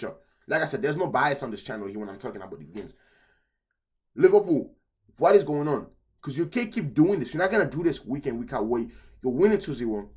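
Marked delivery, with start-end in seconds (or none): 4.1-7.5 loud, higher exasperated plea to the team; exasperated call-out. Real, not acted. Fan yelling at Liverpool over their defense, loud and exasperated. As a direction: shout it at the club like a frustrated fan.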